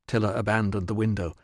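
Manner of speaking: plain reading voice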